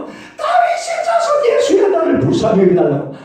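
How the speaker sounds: mock bellow, pitch jumping then loud; hollering at the top of his lungs, half laughing. Bartimaeus bellowing at Jesus, hammed-up laughing bellow. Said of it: "고래고래 마음을 지르는 거예요".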